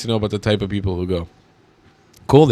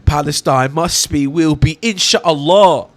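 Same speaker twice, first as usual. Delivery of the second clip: louder, slow hammed-up chant, stretched inshallah; mocking the singer; 5 semitones higher, 6 dB louder, slower than usual. YouTuber parodies the singer's chant, loud and drawn out. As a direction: parody the singer's sing-song line. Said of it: "especially with the accent".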